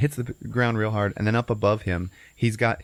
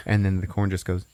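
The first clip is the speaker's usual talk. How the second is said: ordinary talk, nothing marked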